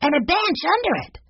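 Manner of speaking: put-on very high, eager child voice; storybook boy exclaiming in delight; Benny's excited cry. A narrator's reading. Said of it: "cried Benny".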